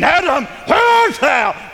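high, loud, slow angry call. Retold or imitated. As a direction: thunder out the name like a wrathful judge. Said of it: "God didn't go angry and saying".

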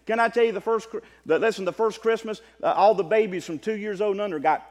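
loud preacher in full flow, climbing high; fiery preaching. Acted. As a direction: thunder it from the pulpit to the brethren. Preaching against cancel culture.

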